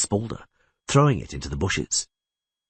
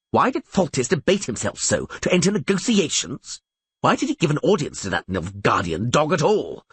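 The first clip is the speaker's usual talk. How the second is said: higher, mildly angry complaint; indignant rant; 7 semitones higher than usual. A narrator's reading. Bronibor rails at Foltest's long debating, indignant. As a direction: fume with impatient questions. Bronibor rants at the closed doors.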